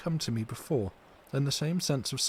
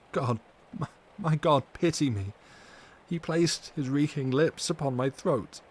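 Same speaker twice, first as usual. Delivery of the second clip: rising, louder cry for pity; anguished plea; 3 semitones higher, 3 dB louder than usual. Acted. Mina crying out as the Count bites, rising anguish. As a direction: plead out in anguish.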